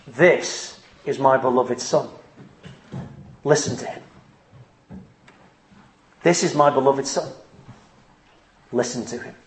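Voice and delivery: a hint of a divine voice, hushed and grave; voice of God from heaven. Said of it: "God spoke from heaven in a voice and said".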